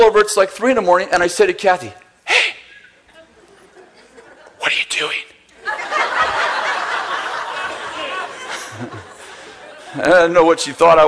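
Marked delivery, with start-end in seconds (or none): 2.3-5.2 laughing whisper to his sleeping wife; hushed, giggling nudge at three in the morning; whispering to wake his wife at night, laughing. Retold or imitated. 5.7-9.0 airy wheezing giggle; a breathy, helpless high laugh; laughing at himself while retelling it; high breathless laugh. Real, not acted. Laughing at his own story.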